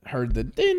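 ordinary delivery

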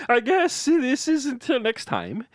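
put-on a young man's shaky farewell video, held together; grieving streamer. Jason tells his followers Liam is dead, tearful. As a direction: keep it together through the tears.

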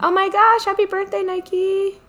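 very high, bright birthday squeal; excited squeal. Real, not acted. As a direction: gush it out, way up high. Birthday wish to a friend.

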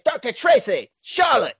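calling out high and urgent for the missing. Acted. Heroes calling for Doctor Tracy and Charlotte in the empty flat, high and urgent.

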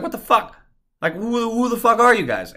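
high, incredulous retold outburst; exasperated outrage. Truck owner raging at careless valets, exasperated outrage. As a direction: pitch shooting up in disbelief.

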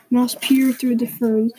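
plain reading voice

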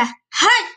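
high yelp of pain. A narrator's reading. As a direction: yelp as if burned. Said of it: "gritó el lobo".

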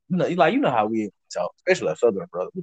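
ordinary talk

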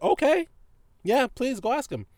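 high-pitched mock-agreeable reply; amused mock agreement. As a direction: go way up high, humoring him. Amused, waving off doubting customer.

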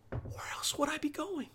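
very soft, high, whispery retort; hushed retort. Acted. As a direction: answer mom under your breath, incredulous. Acted retort to mom, first take.

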